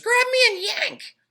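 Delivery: tiny, high, loud tempting voice; pigtail voice. A narrator's reading. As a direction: lure him like a little devil. Pigtail urges him.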